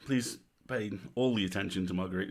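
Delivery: ordinary talk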